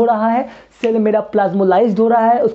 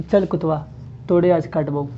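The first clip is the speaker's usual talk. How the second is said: a lower, softer Bihari village threat to a dog; 5 semitones lower, 5 dB quieter than usual. Retold or imitated. Teacher mimics a Bihari villager scolding a dog, low and rustic. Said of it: "tone हमको सारा पता है".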